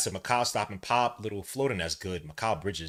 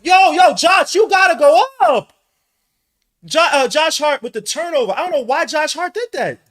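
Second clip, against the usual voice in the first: much higher, much louder frustrated shout; frustrated yelling; 16 dB louder than usual. Real, not acted. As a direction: yell at the TV in disbelief.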